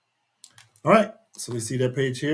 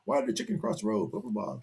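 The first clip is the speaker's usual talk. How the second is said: joking quote; 6 semitones higher, 7 dB quieter, faster than usual. Acted. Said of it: "at the beginning of a joke".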